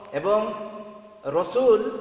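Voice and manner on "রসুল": রসুল with rounded o, "Ro-sul"; correct pronunciation. Shown as a demonstration. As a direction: round the first vowel of রসুল. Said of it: "এটি শুদ্ধ". (শুদ্ধ উচ্চারণে "রোসুল")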